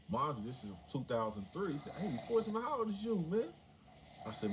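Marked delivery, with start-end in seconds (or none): 2.0-3.4 much higher, louder incredulous cry; can't believe the number; disbelief. Retold or imitated. Young inmate shocked at guard's remark, high disbelief.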